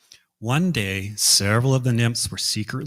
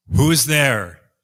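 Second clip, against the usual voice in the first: calling out; 4 semitones higher, 8 dB louder, slower than usual. Acted. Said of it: "he called out".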